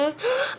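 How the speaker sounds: high, soft intake of breath; excited gasp. Real, not acted. Slime fan about to open the container, excited gasp. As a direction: gasp with anticipation. Thrilled while unboxing.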